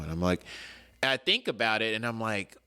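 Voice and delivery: usual speaking voice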